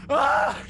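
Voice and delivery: high short terrified shriek; panicked scream. Acted. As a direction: scream and run away. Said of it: "I'm like".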